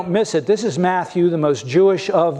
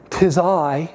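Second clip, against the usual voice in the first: mock old English. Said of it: "That's not what he says".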